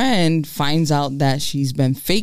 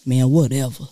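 acted dismissal; 4 semitones lower than usual. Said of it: "He's like".

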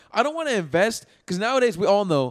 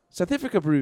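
put-on South African accent; 2 semitones higher than usual. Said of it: "trying to do a South African accent".

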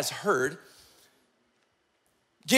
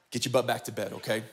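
low, soft, dry order; low dismissal; 9 semitones lower, 8 dB quieter, faster than usual. Retold or imitated. Eli sending Samuel back to bed, quietly dismissive. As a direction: pitch it down and send him off. Priest sends boy off again.

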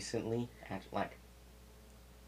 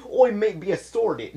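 loud, high mock-British yelp; bad medieval British accent, pitched way up; hammed-up, horrible British shout; 12 semitones higher, 12 dB louder than usual. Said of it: "I tried to do a horrible British accent".

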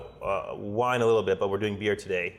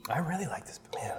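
whisper; 8 semitones higher, 7 dB quieter than usual. Real, not acted. Whispering while tasting beer.

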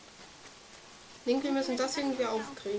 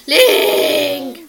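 calling out; 13 dB louder than usual. Real, not acted. Calling out to companion.

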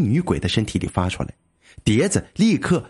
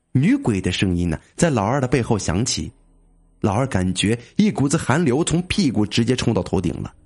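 usual speaking voice

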